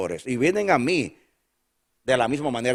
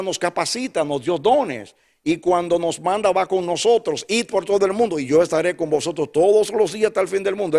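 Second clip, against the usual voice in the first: fiery preaching; 5 semitones higher, 4 dB louder than usual. Acted. Pastor preaching fervently to his church. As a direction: preach it out to the congregation.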